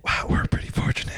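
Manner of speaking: soft close whisper; whispered asmr role play. Pretend. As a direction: whisper as if a child might hear. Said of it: "we're just gonna whisper".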